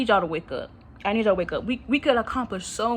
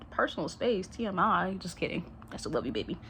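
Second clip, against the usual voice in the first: quiet aside to cat; 4 semitones lower, 10 dB quieter than usual. Real, not acted. Aside to cat licking him.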